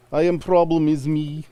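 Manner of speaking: high, slow, hammed-up Russian accent; a broad, clumsy Russian accent; bad Russian accent. Joking cohost turns the song line into a bad Russian accent. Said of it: "Russian accent".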